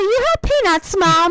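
imitating the kid. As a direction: chirp it up like a little boy. Boy voiced asking mother.

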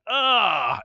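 loud pained crowd groan, high; anguished groan. Retold or imitated. Fans groaning as the game is lost. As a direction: groan like the team just lost.

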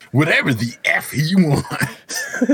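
slow, high drawn-out tease; drawn-out parody. Pretend. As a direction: stretch it out mockingly, high and slow. Parodies the cohost's drawn-out line.

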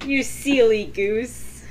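drawn out, teasing; slow teasing drawl. Pretend. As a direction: savour each word slowly. Drawn-out playful repeat.

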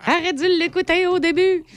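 high put-on voice; mock voice. Mock retort to the quoted mother.

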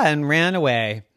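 ordinary delivery, nothing marked

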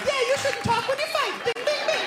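very high mocking kid voice; little brothers gloating at the loser; squeaky singsong taunt. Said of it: "we all walked past him like".

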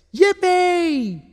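very high, gleeful little whoop; joyful cheer. A narrator's reading. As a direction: cheer like a triumphant child. Roma cheers after clearing the rock.

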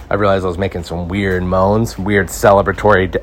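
ordinary talk

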